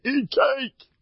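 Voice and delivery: high, bright mock quote of the old rabbi; quoting Rabbi Miller. Rabbi quoting Rabbi Miller's advice, high. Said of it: "Rabbi Miller used to say".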